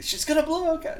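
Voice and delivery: high hammed-up Scotty voice; lightly voiced Star Trek engineer; Scotty voice for chat; Scotty impression. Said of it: "Oh, Scotty".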